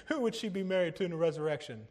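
quick question through a leftover laugh. Retold or imitated. Preacher retelling the Sadducees' trick question, amused and laughing. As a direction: rush the question out while chuckling.

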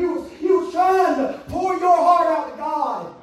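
impassioned, driving sermon delivery; full-force preaching. Acted. Preacher describing pouring a heart out to God, impassioned. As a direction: preach it hard in surging phrases.

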